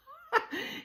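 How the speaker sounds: high mischievous giggle; imitated giggle. Too Tall giggles about busting the new guards, high. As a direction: giggle like you're in on a joke. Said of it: "then she starts to giggle".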